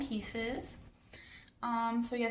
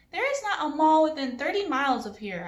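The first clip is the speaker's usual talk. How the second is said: raised, whiny complaint; louder and higher, a teen griping; complaining; 6 semitones higher, 5 dB louder than usual. A narrator's reading. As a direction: kid grumbling to dad about no mall. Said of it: "I complain".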